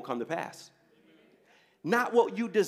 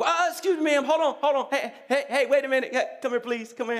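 higher, urgent calling after someone; 7 semitones higher, 3 dB louder than usual. Acted. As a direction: flag down a waitress who is walking off. Calls waitress back in story.

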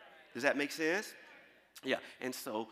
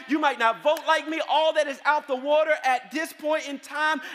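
loud, high, rousing sermon voice; full-force preaching; 10 semitones higher, 10 dB louder than usual. Acted. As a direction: call it out to the whole church, pushing each line.

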